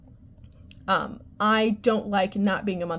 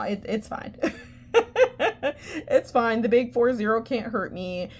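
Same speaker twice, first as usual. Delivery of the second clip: usual speaking voice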